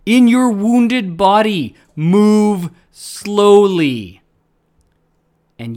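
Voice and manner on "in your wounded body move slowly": loud, high and slow shout; screaming. Retold or imitated. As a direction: scream the warning to yourself. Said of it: "scream to me".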